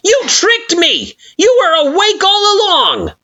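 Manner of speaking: betrayed and livid; angry accusation. Acted. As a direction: hurl the accusation. Flowey accusing Frisk.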